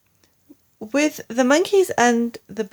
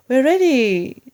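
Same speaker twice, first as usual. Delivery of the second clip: eager shout; 5 semitones higher, 6 dB louder than usual. Acted. Monkeys answer together.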